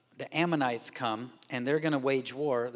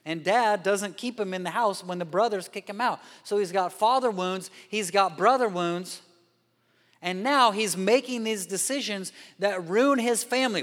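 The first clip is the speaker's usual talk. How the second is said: preaching at full force; 6 semitones higher, 6 dB louder than usual. Real, not acted. Preacher's climax.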